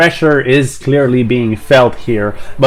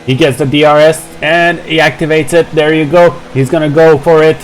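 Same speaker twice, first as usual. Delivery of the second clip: rising live play-by-play; motorsport commentator; 4 semitones higher, faster than usual. Real, not acted. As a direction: call the overtake as it happens.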